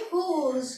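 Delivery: whose as French rose, starting on a throaty r; French pronunciation of whose. Shown as a demonstration. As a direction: open whose with a breathy French r, end on z. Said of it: "इसको पढ़ेंगे".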